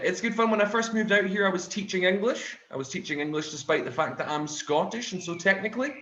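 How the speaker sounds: usual speaking voice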